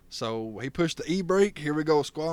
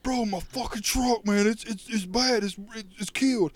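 whiny upset friend; 7 semitones higher than usual. Retold or imitated. Retold friend lamenting wrecked truck.

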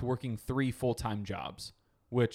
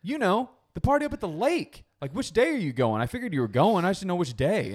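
mom voice; 6 semitones higher, 4 dB louder than usual. Retold or imitated.

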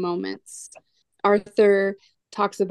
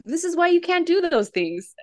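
a lightly voiced Brain, higher; mild lecturing kid voice; know-it-all explaining; 10 semitones higher than usual. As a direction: patiently explain like a smart kid. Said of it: "explains to him, like".

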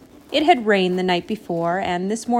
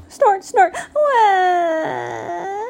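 gasps, loud snorts and a long high wail; cartoon crying with honking snorts; gasp, snort, then wail loudly; hammed-up bawling; 11 semitones higher, 4 dB louder, much slower than usual. A narrator's reading. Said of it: "began to cry. Big, loud, honking snorts".

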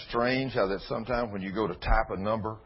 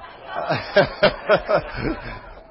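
big loud laugh that breaks into a shout; loud whooping laugh; 5 semitones higher, 5 dB louder than usual. Real, not acted. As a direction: let a full laugh burst out.

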